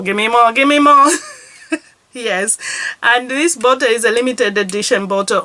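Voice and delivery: normal talking voice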